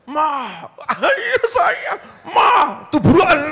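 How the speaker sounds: high, loud cooing husband; mock tender coaxing. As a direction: coo at her like a lovesick husband, way up high. (suami merayu istri dengan suara tinggi dan keras)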